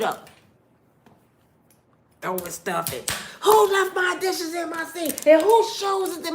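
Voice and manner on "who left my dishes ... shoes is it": high, loud mock rant; mother snapping at her kids; retold angry yelling. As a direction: rant at the household mess. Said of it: "walking around mad".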